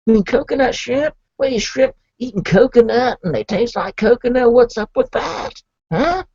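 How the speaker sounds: high, worked-up protest about coconut; hammed-up outrage over the order; indignant excitement. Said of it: "And I'm like".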